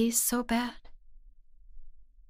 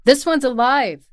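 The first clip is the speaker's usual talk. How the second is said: exclaiming; 7 dB louder than usual. Acted. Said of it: "I could hear them exclaim".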